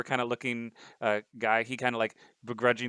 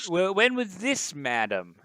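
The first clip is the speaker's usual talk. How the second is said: reporter voice; 7 semitones higher, 4 dB louder, slower than usual. Acted. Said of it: "then he's like".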